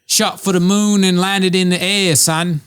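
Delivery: lightly voiced British guy; a hint of British accent. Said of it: "Do a Brendan Chavez as a British guy".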